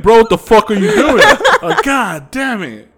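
loud, high-pitched disbelief, half laughing; exasperated outburst. Retold or imitated. Retelling exasperation at his idle friends.

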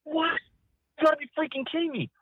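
very high, fast, incredulous; can't believe what he hears; stunned disbelief. Retold or imitated. Cameraman reacting to his boss's criticism, very high and fast. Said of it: "And I'm like".

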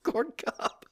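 high, squeaky-pitched laughing words. Real, not acted. Host repeating the absurd list item, laughing. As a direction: squeeze it out through helpless laughter. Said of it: "Can't say it without laughing".

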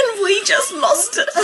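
high, squealing laugh through the words; helpless laughter. Real, not acted. Telling how two friends cracked up, laughing. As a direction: barely get it out for laughing.

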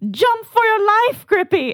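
high, loud rallying cry; heroic shout. Acted. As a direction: yell it like a charge. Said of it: "I go".

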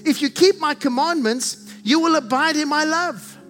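normal talking voice

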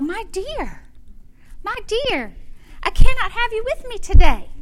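high-pitched little lady voice; high, lilting old-fashioned lady; little lady to dog. Retold or imitated. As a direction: fondly scold a pet in a high voice. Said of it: "she said to the dog".

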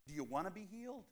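gently ask, voicing a retold line; quietly acted question; soft question. Voices Jesus asking the sick man.

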